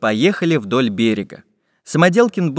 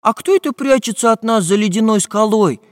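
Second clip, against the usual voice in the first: high, lively questioning voice; curious Prutik; 9 semitones higher, 4 dB louder than usual. A narrator's reading. Asking who hides behind the ice cliff, high and curious. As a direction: ask with keen interest, pitched up. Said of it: "заинтересовался Прутик".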